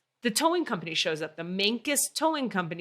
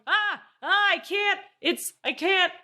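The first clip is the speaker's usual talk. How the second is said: panicked; 11 semitones higher, 4 dB louder than usual. Retold or imitated. Driver panicking as car slides.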